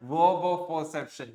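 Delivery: "Verb of perception" dragged out very slowly; drawn-out term. Shown as a demonstration. Teacher labelling the board example, very slow. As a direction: stretch the term for the class. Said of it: "teacher drills the term".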